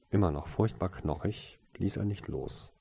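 plain reading voice

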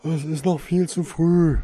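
hiding a smile. A narrator's reading. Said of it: "sein Lächeln war nicht in seiner Stimme hörbar".

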